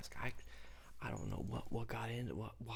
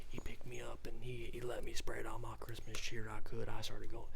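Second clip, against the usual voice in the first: ordinary delivery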